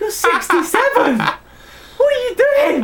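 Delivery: squeaky outraged disbelief; shocked high-pitched squeal. Retold or imitated. Retold shocked reaction to coworker.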